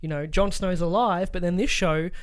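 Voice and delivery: usual speaking voice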